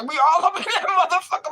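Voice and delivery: high, laughing through the words; cracking up while telling it. Real, not acted.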